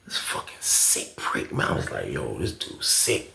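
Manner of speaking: very quiet, low and appalled; appalled remark kept under the voice; disgusted aside. Retold or imitated. Listener reacting to a sick cellmate story, hushed disgust.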